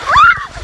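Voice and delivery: high shriek on the splash; excited scream. Real, not acted. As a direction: let out a thrilled scream. Splash down waterfall.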